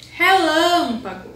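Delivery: Relâmpago slow and loud, LÂM held high and long; stress on LÂM. Shown as a demonstration. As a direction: stretch the strong middle syllable of Relâmpago. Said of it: "A sílaba mais forte é o". (Relâmpago com o LÂM forte e alongado)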